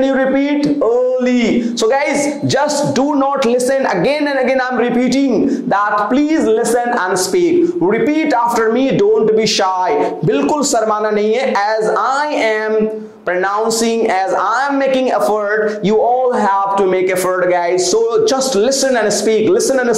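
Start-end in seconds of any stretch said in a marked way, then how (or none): none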